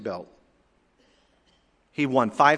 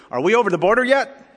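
high, loud question in mock broken English; 10 semitones higher, 8 dB louder than usual. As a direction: lightly imitate broken English, calling out. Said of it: "the voice said in broken English".

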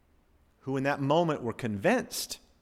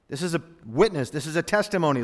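ordinary talk, nothing marked

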